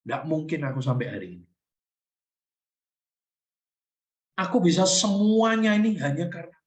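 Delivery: hushed, intimate talk to God; preacher's grateful inner prayer; heartfelt prayer. Acted. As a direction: pray it from the heart. Prays aloud within sermon.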